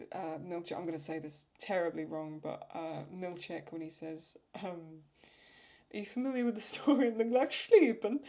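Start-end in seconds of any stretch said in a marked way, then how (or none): 5.9-8.2 louder, higher-pitched mock quote with a showy "Gluckschliepen"; imitated odd pronunciation. Fan quoting a favourite line from the show, imitated odd pronunciation. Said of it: "the way he pronounces it".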